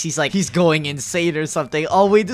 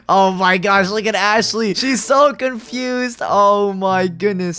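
ordinary talk, nothing marked

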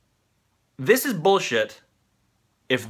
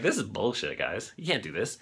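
low, hushed mock Trump grumble, much softer; 7 semitones lower, 9 dB quieter than usual. Host imitating Trump tweeting about the ethics office, low and hushed. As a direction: sink low and quiet, sending up Trump's tweet. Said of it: "He's like".

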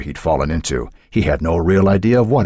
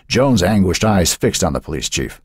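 plain reading voice

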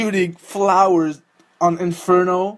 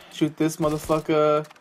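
ordinary talk, nothing marked

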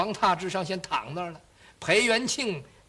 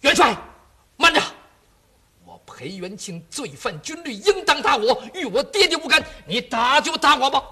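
loud, pleading son cutting in; urgent protest; 5 semitones higher, 5 dB louder than usual. Acted. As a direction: shout to stop the marshal and take the blame. 儿子抢着认罪. (儿子高声抢着认罪)

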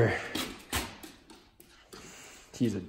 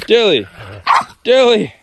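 louder, higher sharp call of the name; calling the dog; 6 semitones higher, 5 dB louder than usual. Real, not acted. Owner calls Dilly to drop the toy, sharp raised call. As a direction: snap the dog's name to stop her.

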